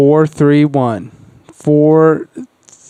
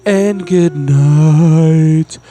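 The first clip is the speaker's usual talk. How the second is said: radio sign-off; much slower than usual. Acted.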